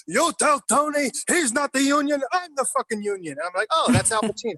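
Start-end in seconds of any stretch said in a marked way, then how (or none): 0.1-3.3 imitating an angry outburst. Retelling Pacino's real take on set, a hammed-up furious shout. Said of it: "this guy stands up and goes". 3.7-4.5 higher, bright sudden recognition; the penny drops, say it in amazement; surprised realization. Retold or imitated. Recalling recognizing Pacino on set, mock surprised.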